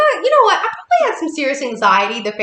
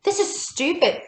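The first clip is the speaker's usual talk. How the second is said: higher, exasperated complaining to her friend; mock arguing; 6 semitones higher than usual. Arguing with Leslie at the fire walk, exasperated. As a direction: act out the bickering, fed up. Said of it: "we're arguing with each other".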